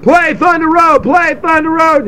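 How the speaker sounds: high, drawn-out mock crowd scream; screaming audience. Audience begging [name] for a favorite, screaming. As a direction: yell the request like a rowdy fan. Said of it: "the audience was screaming".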